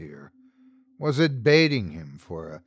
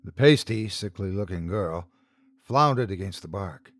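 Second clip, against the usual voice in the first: ordinary delivery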